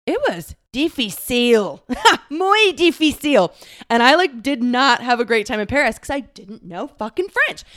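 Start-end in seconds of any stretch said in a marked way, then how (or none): 0.1-3.5 slow, playful Spanish "difícil, muy difícil"; mock Spanish. Joking about struggling in Paris, a mock Spanish lament. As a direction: lean into a hammed-up Spanish accent.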